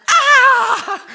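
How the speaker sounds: sudden very high loud caught-out shriek; startled yelp. Retold or imitated. Pastor startled at being asked a question, high loud yelp. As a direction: yelp like you've just been caught. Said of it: "Like it's busted".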